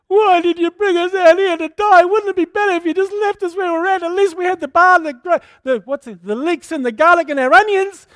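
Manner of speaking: high, whining complaint of a scared crowd; whining Israelites. Retold or imitated. Preacher voicing the complaining Israelites, high and whining. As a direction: moan it like frightened grumbling people.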